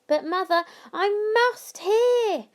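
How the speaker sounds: put-on high, loud pleading boy. A narrator's reading. Charlie begging mother to hear the rest, pleading. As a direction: beg to stay up.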